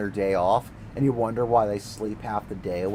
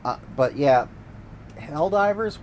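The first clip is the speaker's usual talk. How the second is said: normal talking voice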